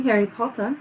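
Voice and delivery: lightly British Harry Potter, r dropped at the end; a hint of British accent on Harry Potter; attempted British accent. Shown as a demonstration. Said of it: "Sorry for the accent".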